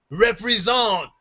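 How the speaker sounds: high French Canadian cheer, last syllable stretched; French Canadian accent. Retold or imitated. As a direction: imitate French Canadian kids hyping you up. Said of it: "These, these French kids".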